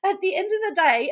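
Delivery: laughing through the words, disbelieving. Real, not acted. Wondering aloud why he wouldn't want her, laughing. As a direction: giggle it out, amused and baffled.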